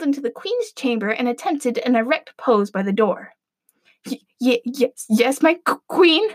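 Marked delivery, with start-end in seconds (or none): 4.0-6.3 nervous stammer on each word; stuttering. Acted. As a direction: stammer like a scared servant. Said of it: "he stuttered".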